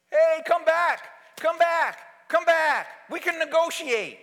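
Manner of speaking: loud, high, slow pleading call; loud mock pleading after someone leaving; mock begging. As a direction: ham up a desperate plea. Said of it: "There is no begging from Jesus".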